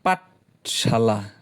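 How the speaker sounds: slow, drawn-out syllables; pronounced slowly, beat by beat; syllable-split. Shown as a demonstration. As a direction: stretch out each syllable to count beats. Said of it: "এইখানে তিন আর মোর এক".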